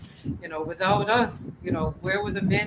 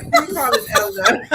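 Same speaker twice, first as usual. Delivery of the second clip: indignant; 10 semitones higher, 8 dB louder than usual. Retold or imitated. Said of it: "I'd be like".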